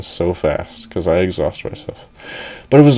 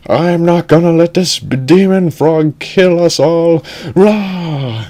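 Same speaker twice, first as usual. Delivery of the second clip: rally the crew like a cartoon hero; heroic battle cry; 8 semitones higher, slower than usual. Baboomba vows to save everyone, heroic high cry.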